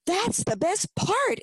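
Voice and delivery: high, drawn-out delighted exclamation; exclaiming. A narrator's reading. Emily answering Daddy about the yellow bedroom, excited exclamation. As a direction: burst out high and stretch the words. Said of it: "Emily exclaimed".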